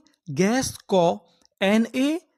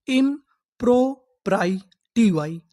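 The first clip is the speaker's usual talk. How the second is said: slow syllables; much slower than usual. Shown as a demonstration. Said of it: "तो इतनी meaning क्या बनी?".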